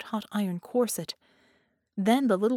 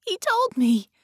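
put-on high and loud child's cry, half sobbing; a child pleading through tears; much higher, louder tearful child; tearful insistence; 12 semitones higher, 8 dB louder than usual. A narrator's reading. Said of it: "she half sobbed".

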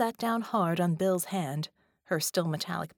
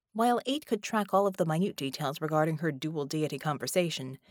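usual speaking voice

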